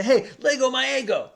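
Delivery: put-on high, loud kid's voice snatching the Eggo; kid grabbing waffle. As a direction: shout it like a greedy kid grabbing breakfast. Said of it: "this other kid would grab it and say".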